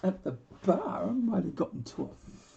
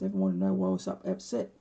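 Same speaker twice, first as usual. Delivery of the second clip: normal talking voice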